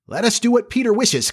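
put-on high-pitched, obedient boys; eager cry. A narrator's reading. Boys obey their leader's supposed order, high eager cry. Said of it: "cried the simple boys".

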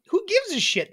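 high-pitched, tossed-off dismissal; flippant. Acted. Host demonstrating harmless swearing to co-host, flippant and breezy. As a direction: toss it away, pitched well up. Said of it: "When someone's just saying it flippantly".

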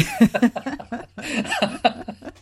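a long, high burst of laughter that falls away; real amused laugh; hearty laugh. Real, not acted. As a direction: laugh at the memory. Laughing at his own story.

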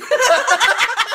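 shrieking laugh; loud laugh. Real, not acted. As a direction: howl with laughter. Big laugh.

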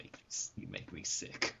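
unvoiced, hissed villain line; a sinister hushed sneer; whispered villain. Villain line in a Vincent Price voice.

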